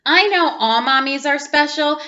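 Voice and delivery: ordinary delivery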